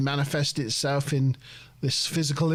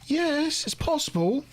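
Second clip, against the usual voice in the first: much higher mock android voice; host imitating Data, a higher mock voice; 6 semitones higher than usual. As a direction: play Data, measured and deadpan but high. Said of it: "Data's like".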